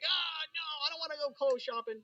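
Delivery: put-on high, whiny child's complaint; kid complaining. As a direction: whine like a kid dragged shopping. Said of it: "I'd start complaining like".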